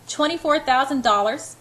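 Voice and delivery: "Twenty-four thousand dollars?" rising high and loud in shock; almost scream it in disbelief; near scream. A narrator's reading. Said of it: "I'm almost screaming".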